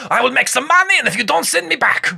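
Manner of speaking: put-on thick German immigrant accent. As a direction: plead it in a heavy German accent. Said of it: "your German accent legitimately took me by surprise".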